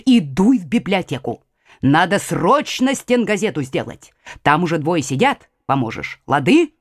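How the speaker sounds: usual speaking voice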